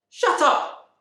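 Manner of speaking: high, loud shout. Retold or imitated. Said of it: "Queenie shouted".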